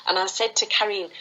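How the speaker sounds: ordinary talk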